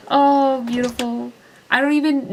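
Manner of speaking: a loud high swooning oh; real delighted gasp of joy; gushing. Real, not acted. Gushing over a character.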